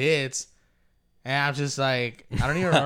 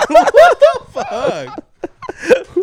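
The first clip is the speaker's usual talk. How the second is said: high, loud burst of laughter into words; real laughing fit; 13 dB louder, much slower than usual. Real, not acted. Breaking up at his own whisper bit, laughing hard. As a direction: crack up while swearing.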